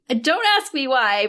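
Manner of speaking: ordinary talk, nothing marked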